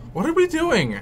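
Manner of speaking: surprised. Real, not acted. Confused at screen.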